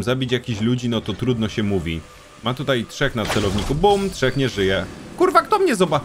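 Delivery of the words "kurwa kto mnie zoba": sudden high yelp, cut off mid-word; startled outburst. Real, not acted. Gamer spotted by a guard, startled high yelp. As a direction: caught off guard, blurt it out.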